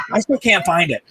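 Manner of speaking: high, fake-puzzled; mock innocent search; feigned searching. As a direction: pretend to hunt for it. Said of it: "I go".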